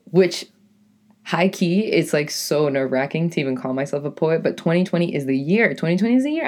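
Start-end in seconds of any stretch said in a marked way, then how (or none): 5.8-6.5 excited. Real, not acted. Repeating with hype.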